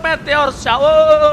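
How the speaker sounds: slow, loud drawn-out call; excited esports caster. Real, not acted. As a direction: stretch out the big moment. Hype caster call of a fight.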